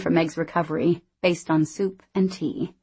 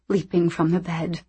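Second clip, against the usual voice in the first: usual speaking voice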